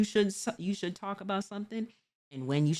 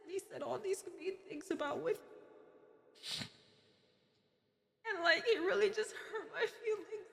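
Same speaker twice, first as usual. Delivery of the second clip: high, soft, fake-hurt streamer voice; hammed-up teary plea to her viewers; mock victim; 10 semitones higher, 7 dB quieter than usual. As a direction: whimper it to chat like the wounded party. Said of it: "took that role of just like".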